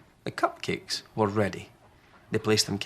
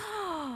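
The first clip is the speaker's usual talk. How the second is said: gasp; 7 dB quieter than usual. Acted. Children gasp.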